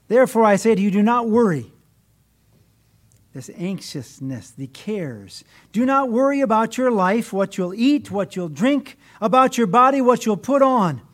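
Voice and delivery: loud, emphatic Bible reading; reading the Sermon on the Mount with force; scripture reading. Acted. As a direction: proclaim Jesus' words to the congregation. Reads scripture aloud from the pulpit.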